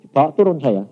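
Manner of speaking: ordinary talk, nothing marked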